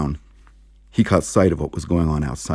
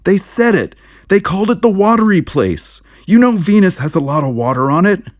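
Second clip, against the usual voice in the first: high, loud frantic insisting; agitated protest; 14 semitones higher, 6 dB louder than usual. Acted. Narrator frantically arguing with the sheriff about Venus. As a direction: pile on the disbelief.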